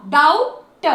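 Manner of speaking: "डाउट" with the b silent, said loud and clear; correct pronunciation. Shown as a demonstration. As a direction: say "डाउट" firmly, no b sound. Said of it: "ओयू को आउ".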